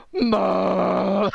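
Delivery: loud, high honking blast; sudden robot horn blast; robot train horn. Acted. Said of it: "the robot just goes".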